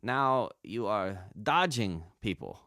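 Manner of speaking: slow, deliberate studio direction; imitated Chinese sound engineer. Sound engineer cueing a voice actor to dodge, slow instruction. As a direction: measure out the instruction word by word. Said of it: "Chinese sound engineers".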